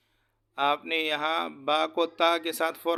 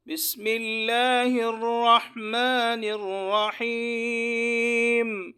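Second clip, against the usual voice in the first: slow melodic tajweed chant, higher and louder, long held vowels; fluent Quran recitation; 5 semitones higher, 4 dB louder, much slower than usual. Shown as a demonstration. Teacher reciting the surah fluently for students to follow, melodic chant. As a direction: intone each verse slowly with long pauses between. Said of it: "ایک مرتبہ پھر آپ حضرات کی آسانی کے لیے دہراتا ہوں".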